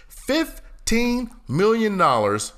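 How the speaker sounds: drawn out, high and slow; incredulous. Acted. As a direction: stretch it in disbelief.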